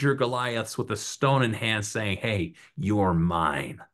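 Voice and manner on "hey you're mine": very slow, low challenge; low, drawn-out mock threat; menacing challenge. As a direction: stare down the giant, drawing out each word. Acts out challenge to Goliath.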